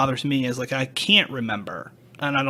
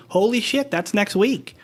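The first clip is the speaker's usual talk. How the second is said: startled realization; 7 semitones higher, slower than usual. Retold or imitated. Realizing the anniversary date.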